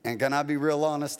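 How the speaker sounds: normal talking voice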